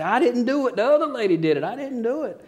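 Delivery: high-pitched, hammed-up denial; imitated protesting mother. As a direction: mimic a woman insisting she is innocent. Mother protests her innocence, retold.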